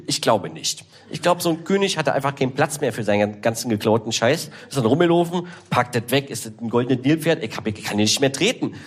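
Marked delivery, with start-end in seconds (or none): none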